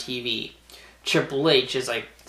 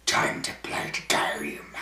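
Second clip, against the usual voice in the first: lower, softer, slow menacing whisper; low entrance voice; 10 semitones lower, 5 dB quieter than usual. Retold or imitated. Wrestler's entrance in the dark, low slow whisper. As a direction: drop the voice low and ominous. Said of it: "Like that".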